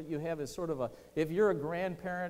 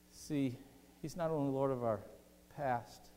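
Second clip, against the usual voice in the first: usual speaking voice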